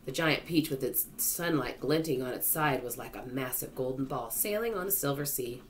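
ordinary delivery, nothing marked

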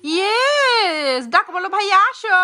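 high, loud sing-song peekaboo; playful peekaboo. Real, not acted. Coaching daughter to call brother.

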